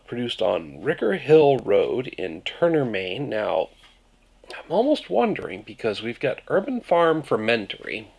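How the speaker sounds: usual speaking voice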